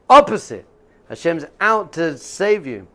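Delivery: loud, high exclamation of the word; a sudden loud high Opposite; emphatic. Real, not acted. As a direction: burst out with it, up and loud.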